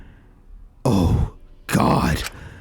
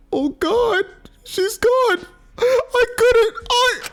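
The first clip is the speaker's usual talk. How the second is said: high, stammering voice on the edge of tears; breaking down; 8 semitones higher than usual. Acted. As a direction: stutter out the words as if breaking down. Shinku breaks down over death.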